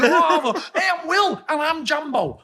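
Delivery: high, loud laddish voice, laughing; imitating Will. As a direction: belt out the catchphrase in a mock chirpy voice. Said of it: "He was like".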